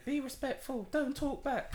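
high, mocking imitation of teachers. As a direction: parrot the teachers' rules way up high. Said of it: "That's all they say".

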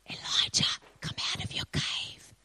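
hushed, airy whisper with space between phrases. Acted. God whispering to Elijah to come out, whispered. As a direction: whisper it as a soft summons. Quoting God, not harsh.

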